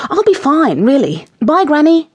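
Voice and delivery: high, eager goodbye; rapid. A narrator's reading. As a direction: rattle off a bright farewell. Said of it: "Jessie said rapidly".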